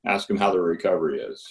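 ordinary delivery

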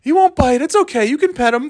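high falsetto dog-owner voice; imagined bike owner. Pretend. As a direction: reassure strangers in a silly high pitch. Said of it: "I'm picturing him, like".

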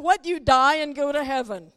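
ordinary talk

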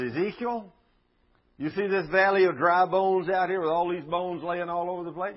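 God addressing Ezekiel. Retold or imitated. God showing Ezekiel the valley of bones, calling out.

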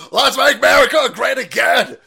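loud, high slogan yell; loud rally chant; rally shout. Real, not acted.

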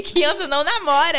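put-on dog's child voice. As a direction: proclaim it like a little kid, very loud. Repeats the dog's child voice, laughing. (voz de criança brincalhona, bem alta)

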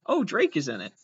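ordinary talk

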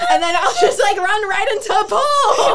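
laughing. Real, not acted. Recounting walking into a pole.

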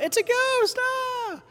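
very high-pitched comic shriek of fright; mock terrified yell. Disciples yelling out in the boat, mock terrified. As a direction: yelp it way up high, playing scared. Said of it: "and we yell out".

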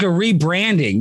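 ordinary talk, nothing marked

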